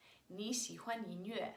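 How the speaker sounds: "你喜欢音乐" in Mandarin, as a statement. Shown as a demonstration. Teacher translating the example, Mandarin statement "你喜欢音乐". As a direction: say "你喜欢音乐" without the question word. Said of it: "you say the statement first".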